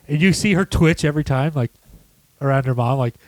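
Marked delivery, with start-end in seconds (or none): none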